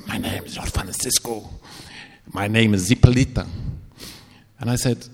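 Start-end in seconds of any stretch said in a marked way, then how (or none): 0.1-3.5 put-on a dark presence speaking through the boy; horrible demonic voice. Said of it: "In this horrible voice".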